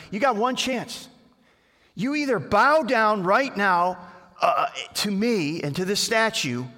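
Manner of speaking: hammed-up royal threat; threatening king. Angry king giving the three friends a last chance.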